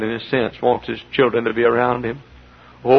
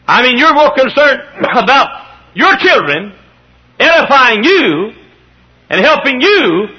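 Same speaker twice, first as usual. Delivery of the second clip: high, slow, emphatic sermon delivery; forceful preaching; 7 semitones higher, 6 dB louder, slower than usual. Real, not acted. Preacher reproving the parents in the room, high and emphatic. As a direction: drive it home slowly and forcefully.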